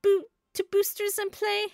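high, singsong cartoon voice; coaxing game character. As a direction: coax the beetle in a high little voice. Voicing game characters coaxing the beetle.

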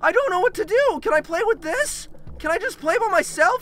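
high, whining plea; anxious pleading. Real, not acted. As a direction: beg to be left alone. Pleading with horror game.

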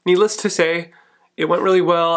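ordinary talk, nothing marked

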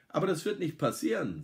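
breezy upbeat reassurance; cheerful. A narrator's reading. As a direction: chirp it confidently. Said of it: "sage ich fröhlich".

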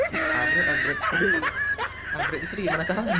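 high laughing disbelief; laughing shout. Real, not acted. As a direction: blurt it out through laughter. Laughing at absurd upgrade joke.

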